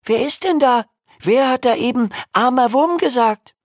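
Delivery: put-on curious boy voice. Jim asking under the sofa.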